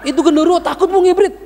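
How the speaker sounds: laughing loudly and high at the punchline. Real, not acted. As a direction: burst out laughing on the climax. (tertawa keras di akhir cerita)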